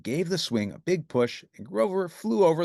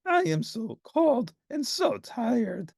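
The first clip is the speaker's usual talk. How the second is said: high, slow weary complaint; weary sigh; 12 semitones higher, slower than usual. A narrator's reading. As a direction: sigh it out, worn through. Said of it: "sighed Grover".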